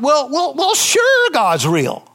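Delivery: high, grudging "well, well, well, sure"; skeptical intellect. Retold or imitated. Preacher voicing his doubting intellect, grudging and high. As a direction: drawl it like a doubter reluctantly conceding. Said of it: "In my intellect, it goes like".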